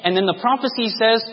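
usual speaking voice

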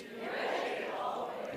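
whispered Be ready always, ready pressed; voiceless, leaning on ready; soft whispered stress on ready. Shown as a demonstration. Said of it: "emphasize each word every time".

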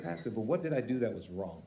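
preacher answering the angry pastor, soft; talking softly. Retold or imitated. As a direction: answer gently under his anger. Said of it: "Talking softly back to him".

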